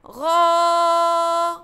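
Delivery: غابة with the long aa of غا stretched slowly; drawn-out long vowel. Shown as a demonstration. Teacher stretching the vowel for first graders, slow and drawn out. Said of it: "عندي في كلمة".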